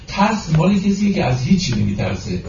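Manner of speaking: ordinary talk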